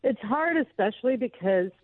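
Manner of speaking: fighting back tears; real crying. Real, not acted. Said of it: "I couldn't get through this without crying".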